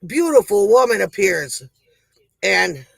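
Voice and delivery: ordinary delivery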